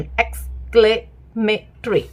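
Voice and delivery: Exclamatory said slowly, split into its parts; slowly broken up. Shown as a demonstration. Teacher showing parents how a child breaks a word, slow. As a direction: stretch exclamatory out so a child can follow it. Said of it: "मुझे ये ऐसे सही लगता है इसका pronunciation".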